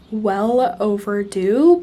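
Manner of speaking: ordinary talk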